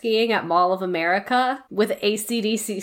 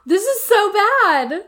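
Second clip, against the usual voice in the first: real horror at the email; shocked and slow; shocked gasp; 11 semitones higher, slower than usual. Real, not acted. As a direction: gasp high then drag it out slowly. Reacts to awful email.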